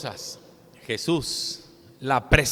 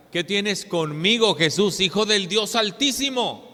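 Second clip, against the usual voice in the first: possessed man's cry; 5 semitones higher, 5 dB louder than usual. Acted. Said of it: "este hombre poseído del demonio decía".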